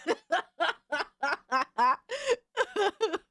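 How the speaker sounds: breathy high laughter; high giggle. Real, not acted. Streamer laughing at a clip, high giggle.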